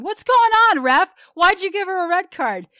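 high, loud sports-parent protest; yelling at the ref. Retold or imitated. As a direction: shout your outrage across the field. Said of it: "as I'm yelling at him".

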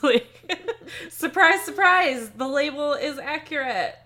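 high, loud and amused through laughter; tickled, giggly mock astonishment; laughing mock surprise. Real, not acted. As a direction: laugh your way through an obvious revelation. Joking that the label is true.

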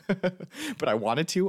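high, slow laugh through the words. Real, not acted. Laughing at herself about failing to cry.

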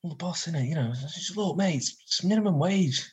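lightly voiced put-upon employee; carried hostage. Captured staff member blaming the boss, lightly voiced underling. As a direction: excuse yourself as a lowly worker. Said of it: "The one who you're carrying says".

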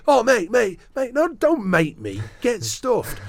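hammed-up furious brush-off, loud and high; mock angry quote, loud and high. Retelling how he stormed off on the footballer, a mock furious yell. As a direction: throw the mate back at him, loud and high. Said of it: "He's like".

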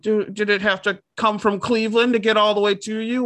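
high, exaggerated pitying coo; mock sympathy. Adoptee mocking rescue-dog owners, high fake pity.

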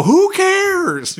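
carefree dismissal. Retold or imitated. Husband brushing off wife's gas question, high carefree. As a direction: toss it off with mock bravado.

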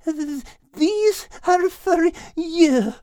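slow, high stammer of a frozen cat; chattering teeth. Acted. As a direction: force it out through chattering teeth. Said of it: "with chattering teeth said".

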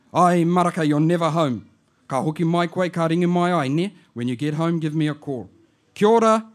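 put-on chatty old auntie on the phone; lightly voiced auntie. Auntie leaving message.

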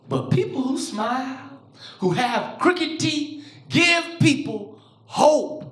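slow, measured words with long pauses; imitated consoling mother. Mother to child teased about crooked teeth, slow reassurance.

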